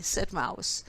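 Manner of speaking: ordinary delivery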